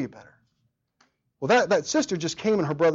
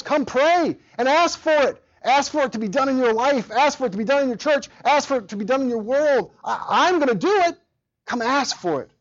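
much higher and louder, pleading invitation; calling out; 9 semitones higher, 4 dB louder than usual. Acted. Preacher voicing Jesus calling the church to pray, loud and urgent. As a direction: summon them to ask, full voice.

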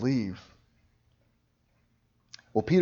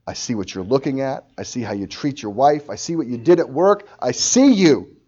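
confronting, building; 3 semitones higher, 8 dB louder than usual. Acted. Jesus confronting a sinner with what he sees, building to a shout.

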